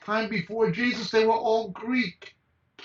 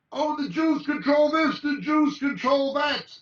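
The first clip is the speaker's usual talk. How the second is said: higher, mock-indignant parody of a crank; mocking quote; 6 semitones higher, 4 dB louder than usual. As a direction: sneer out the claim you are mocking.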